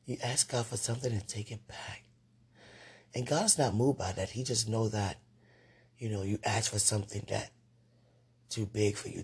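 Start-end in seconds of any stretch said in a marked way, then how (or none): none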